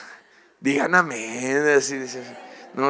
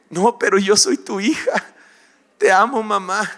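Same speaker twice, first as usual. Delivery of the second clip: put-on higher, louder wheedling child; pleading daughter; 6 semitones higher, 5 dB louder than usual. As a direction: sweet-talk mom to get out of chores. Daughter pleads to avoid dishes.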